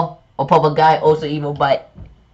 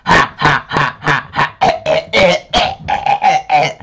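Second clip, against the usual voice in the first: cackling villain laugh, higher; unhinged villain laugh; 4 semitones higher than usual. Pretend. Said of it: "I can't do the voice".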